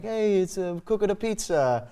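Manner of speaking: high, sing-song mock Italian chef; Italian accent. Shown as a demonstration. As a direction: put on a hammed-up Italian accent. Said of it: "I would do the Italian".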